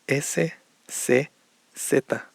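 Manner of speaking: "C" and "Z" start with s, as in "S"; Mexican S, C, Z. Shown as a demonstration. Teacher showing learners Mexican S, C, Z, same s sound. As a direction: say S, C and Z all with one plain s sound. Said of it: "con el mismo sonido".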